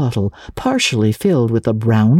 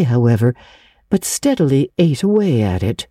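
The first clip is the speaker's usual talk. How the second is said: ordinary delivery, nothing marked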